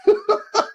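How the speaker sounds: short high burst of laughter; real amused laugh; bright laugh. Real, not acted. Laughing at the boy's question.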